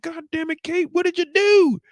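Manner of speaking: high, loud exasperated uncle; mock Tommy yell. Uncle yelling at Kate over the dog bite, high and loud.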